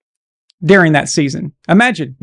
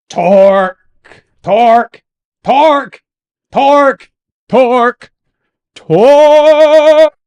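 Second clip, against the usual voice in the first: rising hype yell, louder each time; building shout; 6 semitones higher, 6 dB louder, much slower than usual. Acted. Hyping the word over and over.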